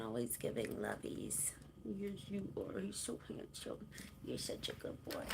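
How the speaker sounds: soft whisper to the cat; whispered pet praise. Real, not acted. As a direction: breathe the praise gently at the cat.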